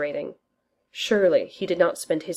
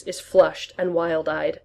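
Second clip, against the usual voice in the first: ordinary delivery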